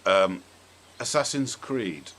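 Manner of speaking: ordinary delivery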